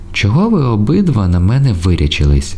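high-pitched indignant question. A narrator's reading. Айзі objects to both men staring at her, indignant. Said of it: "обурилась Айзі".

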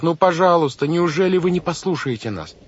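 high-pitched pleading; desperate cry. A narrator's reading. Girl begs the banker to listen, crying out. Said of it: "закричала Вайолет".

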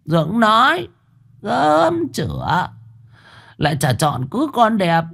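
high, mocking sulk; sulky sarcasm. A narrator's reading. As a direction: needle him with sarcastic praise. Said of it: "ra chiều giận dỗi". (vợ dỗi chồng, giọng cao mỉa mai)

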